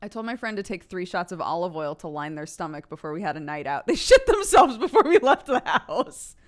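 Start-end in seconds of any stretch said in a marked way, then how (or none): 3.9-6.4 laughing. Real, not acted. Laughing through punchline.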